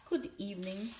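lightly voiced, mumbled false cheer; forced cheer. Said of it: "she mumbled, trying to act cheerful".

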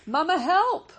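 high, loud panicked scream; desperate cry for help. Acted. Brightbill caught in the robot's hand, screaming to his mother. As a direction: shriek it as the giant hand grabs you. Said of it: "cried Brightbill".

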